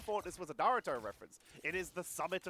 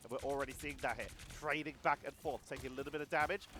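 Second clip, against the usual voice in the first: ordinary talk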